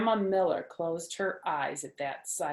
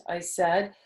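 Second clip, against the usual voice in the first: usual speaking voice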